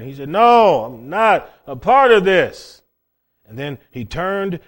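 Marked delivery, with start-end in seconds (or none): 0.3-2.8 loud, raised, drawn-out refusal; angry denial. Retold or imitated. Peter mad at his accuser by the bonfire, angry denial. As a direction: deny it hotly, leaning on each word. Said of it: "Peter got so mad he started swearing".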